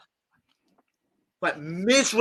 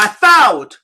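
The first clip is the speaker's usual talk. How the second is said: upset client; 5 semitones higher, 15 dB louder than usual. Retold or imitated. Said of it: "She's like".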